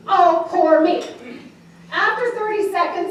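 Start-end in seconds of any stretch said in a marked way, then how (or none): 0.1-1.0 loud, raised poor-me lament; hammed-up pity party; mock self-pity. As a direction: feel theatrically sorry for yourself. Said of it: "Just a pity party".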